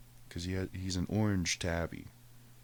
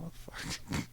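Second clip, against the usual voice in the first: unvoiced curse; 6 dB quieter than usual. Waking to cat, muttered curse.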